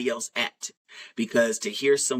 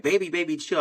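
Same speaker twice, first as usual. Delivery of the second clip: higher-pitched imitation of a flustered mother; urgent hushing plea; 7 semitones higher than usual. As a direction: quickly try to rein in a toddler. Retold mother calming berserk toddler.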